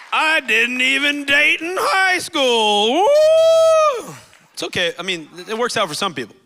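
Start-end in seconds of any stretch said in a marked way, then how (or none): 0.1-4.1 high, loud, slow whooping brag; mock triumphant cheer. Pastor cheering his own dating record as a joke, whooping. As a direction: cheer for yourself like a winner. Jokes about never dating.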